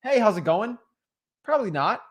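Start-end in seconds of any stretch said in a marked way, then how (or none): 0.0-0.8 higher, informal hello; casual greeting. Shown as a demonstration. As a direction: toss out an easy, natural greeting. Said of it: "is that the most professional?".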